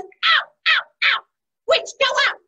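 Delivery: high, loud banishing cries; commanding shout. Acted. Spider throws doors open, commanding shout. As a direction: shout the witch out of the house.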